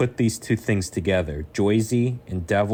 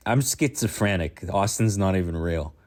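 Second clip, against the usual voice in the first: normal talking voice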